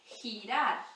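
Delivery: girar with a rasping h-sound; Spanish g as h. Shown as a demonstration. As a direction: say girar with a throaty h at the start, hirar. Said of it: "esse sonzinho de dois R's".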